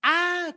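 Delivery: very loud, high "ah"; long anguished high shout; demon's cry. Acted. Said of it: "¿Cómo dijo este demonio?".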